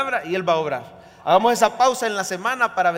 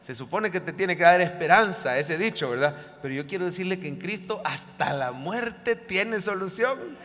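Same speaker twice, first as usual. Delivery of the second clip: ordinary delivery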